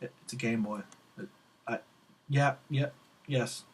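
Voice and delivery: hushed retold reply; shocked, small reply. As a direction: answer meekly, as if dazed. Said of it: "I was just shocked".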